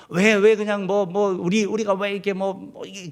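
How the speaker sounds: retold grumbling. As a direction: act out a fumbling, insistent gripe. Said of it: "늘 말마다 그냥 불-, 불망하는". (말을 더듬으며 투덜대는 흉내)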